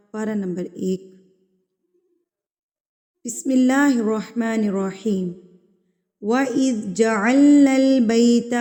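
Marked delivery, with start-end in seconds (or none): 3.3-5.3 slow, melodic tajweed chant; Quran recitation. Shown as a demonstration. 6.2-8.6 melodic tajweed recitation, slightly louder; Quran recitation. Shown as a demonstration. Recites the verse.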